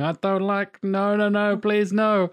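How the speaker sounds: high-pitched helpless begging, played for laughs; mock panicked pleading. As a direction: plead in a high put-on student voice. Acting a student refusing peanuts.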